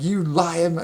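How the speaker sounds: hammed-up furious friend calling them liars; mock angry cry of "liar"; angry accusation. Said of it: "Oh, he was pissed".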